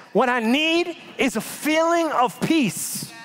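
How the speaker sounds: high, pleading, close to a shout, slowing at the end; a freaked-out believer begging friends to stop quoting facts; anxious plea to friends. Retold or imitated.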